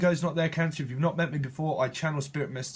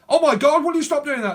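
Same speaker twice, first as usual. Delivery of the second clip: much higher and louder, an exasperated shriek; hammed-up snap at someone being annoying; mock angry outburst; 12 semitones higher, 10 dB louder than usual. Moody Cancer snapping at someone.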